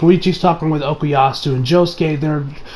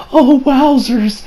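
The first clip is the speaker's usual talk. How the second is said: high, slow, drawn-out wonder; mock awe; 8 semitones higher, slower than usual. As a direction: act out being dreamily amazed. Voicing Koichi's awe, then repeats it plainly.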